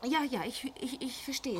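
trembling whimper. Acted. Frightened wife answers husband, faint stammer. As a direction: barely get the words out.

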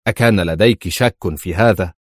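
usual speaking voice

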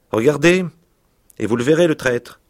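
accusing shout. Acted. Lebrac accusing Baccaillé before his troops, loud and high. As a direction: call out the traitor to the whole gang.

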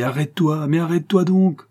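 rising cry, loudest on donc; crying out to himself. A narrator's reading. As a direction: urge yourself to stop, pleading then shouting. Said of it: "je me criais".

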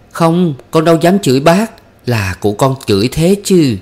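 high-pitched flustered protest from a frightened maid; scared, hasty denial. A narrator's reading. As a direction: protest your innocence quickly, pitched well up. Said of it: "Con bé sợ vội cãi".

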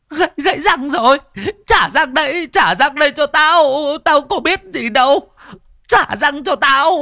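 high, loud possessed wail; wailing ghost. Acted. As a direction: screech for your lost teeth like a demon. Ghost wails over broken teeth.

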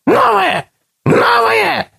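very high shrill creature shout; whistling creature. A narrator's reading. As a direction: squeal it like a greedy beast. Word-eater creatures shouting.

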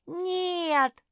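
high, loud, drawn-out refusal; shrieked childish protest; children wailing. A narrator's reading. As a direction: yell it like protesting little ones. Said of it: "заголосили ежата".

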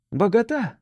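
high call to someone unseen. A narrator's reading. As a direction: call his name across the meadow. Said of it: "крикнул один". (окликая)

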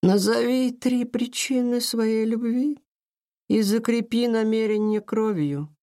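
slow, high echoing ethereal voice; otherworldly voice. A narrator's reading. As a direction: intone it like a spirit, slowly. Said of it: "незнакомым потусторонним голосом продолжила".

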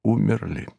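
soft, falling, understated grief; quiet grief. A narrator's reading. Narrator tells that all are dead, in quiet grief. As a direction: let the word drop quietly. Grieving everyone who has died.

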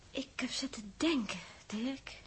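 hesitant whisper to Dirk; soft whispered confession. Acted. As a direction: breathe it out, almost confiding. Girl confides hesitantly to Dirk.

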